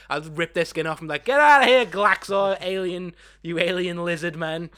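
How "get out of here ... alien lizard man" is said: loud, raised-pitch comic accusation; mock yell. Podcaster ripping the skin off a disguised alien, mock yelling. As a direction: yell it as if exposing an impostor, hammed up.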